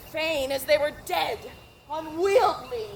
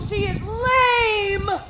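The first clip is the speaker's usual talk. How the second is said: anguished cry; 3 semitones higher, 7 dB louder, much slower than usual. Acted. Juliet fears the nurse delayed.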